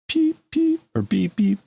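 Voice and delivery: very high, clean electronic beeps; imitated beeps. Reader sounding out the radio cutting off, beeping. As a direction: mimic a machine beeping. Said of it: "goofy sounds coming over the".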